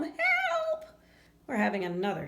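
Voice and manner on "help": shrill, much higher, louder yell for help; screamed cry for help. Acted. Spider fleeing the vacuum drill, shrieked cry for help. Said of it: "ran screaming from the room".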